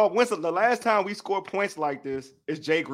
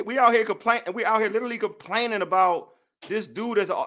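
normal talking voice

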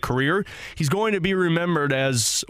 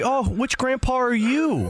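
a hint of a naive newcomer, higher and slow; mock clueless rookie; 8 semitones higher, slower than usual. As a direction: innocently ask the legend who he is. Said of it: "have Joe Vitale walk up to him and say".

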